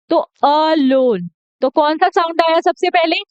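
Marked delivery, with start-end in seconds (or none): 0.4-1.3 अलोन slow, stretching the short a; drawn-out demo. Shown as a demonstration. Teacher slowing down to show the a sound. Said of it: "जैसे कि अलोन".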